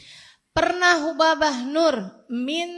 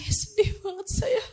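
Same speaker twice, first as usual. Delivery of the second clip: mock sad whine; 8 semitones higher than usual. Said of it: "Saya bilang".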